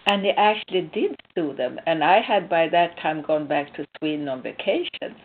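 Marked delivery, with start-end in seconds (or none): none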